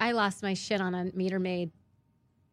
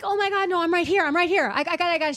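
urgent, high and loud calling out; high-pitched, frantic retold protest; frantic plea; 9 semitones higher, 6 dB louder, faster than usual. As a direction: call out to stop someone in a panic, retelling it. Pleading with meter maid not to ticket.

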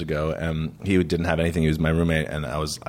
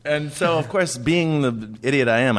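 ordinary talk, nothing marked

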